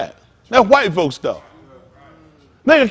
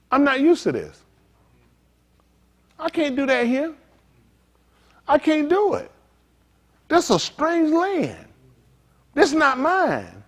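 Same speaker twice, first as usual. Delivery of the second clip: mournful captive; 8 semitones higher, slower than usual. Acted. Captive lamenting in strange land.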